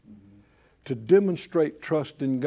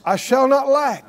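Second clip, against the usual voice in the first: high, emphatic proclamation; out-loud declaration; 7 semitones higher, 4 dB louder than usual. Real, not acted. Preacher leads congregation in confessing faith, out-loud declaration. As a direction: call it out firmly to be repeated. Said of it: "everybody say it out loud".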